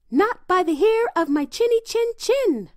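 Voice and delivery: very high, loud piglet voice; little pig voice. A narrator's reading. Little pig refusing the wolf at his door, high defiant squeal. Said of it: "said the little pig".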